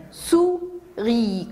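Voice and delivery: "souris" split into sou, ri; syllable by syllable. Shown as a demonstration. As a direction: separate "souris" into two beats with a pause. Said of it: "Combien y a-t-il de syllabes".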